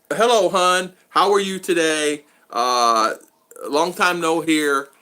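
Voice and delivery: mocking a stranger's syrupy messages; mock sweet greeting. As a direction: put on the stranger's cooing voice.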